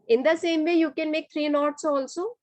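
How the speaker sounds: ordinary delivery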